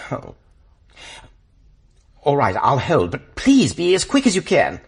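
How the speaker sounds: ordinary delivery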